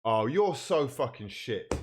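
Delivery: ordinary delivery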